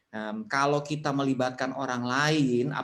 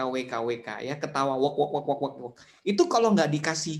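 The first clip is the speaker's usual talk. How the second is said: ordinary talk, nothing marked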